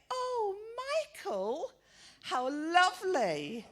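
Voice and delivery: high, slow delighted coo; old lady mishearing the name and gushing. Retold or imitated. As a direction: gush as if the name is lovely.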